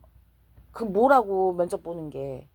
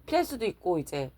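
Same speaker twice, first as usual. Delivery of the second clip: ordinary talk, nothing marked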